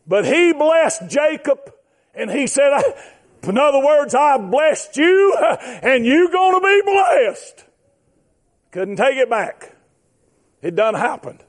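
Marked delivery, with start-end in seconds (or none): 4.1-7.6 high, drawn-out pulpit cry; slow, raised declaration; emphatic preaching. Acted. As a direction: stretch the words out like a preacher. Preaching, voicing the father.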